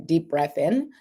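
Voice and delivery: calm, slow breathing cues; steadying breath. Shown as a demonstration. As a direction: lead the breath gently. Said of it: "let's do that one more time".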